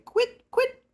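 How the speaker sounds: very high, chirped bird call; partridge call. Acted. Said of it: "the partridge say".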